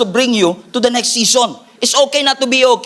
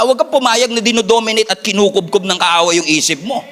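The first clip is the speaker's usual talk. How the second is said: ordinary talk, nothing marked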